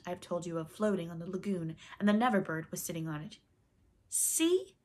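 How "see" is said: high, rising one-word call; Hook. A narrator's reading. Hook shows Smee the bird, high rising call. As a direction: call it out high, pointing something out. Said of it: "said Hook".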